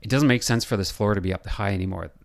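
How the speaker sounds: ordinary delivery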